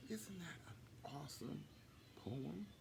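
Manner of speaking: soft, breathy awe; whispered aside. Real, not acted. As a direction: lean in and whisper. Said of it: "narrator whispering aside".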